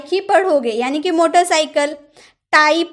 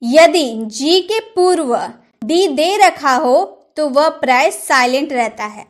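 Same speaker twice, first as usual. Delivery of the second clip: normal talking voice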